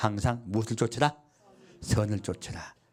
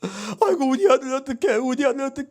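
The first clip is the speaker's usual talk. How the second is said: high, louder mock wailing mother; mother's mock wail; 13 semitones higher, 5 dB louder than usual. Mother crying over her bankrupt son, mock wail. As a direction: wail it like a grieving mother, hammed-up. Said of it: "우는 거예요".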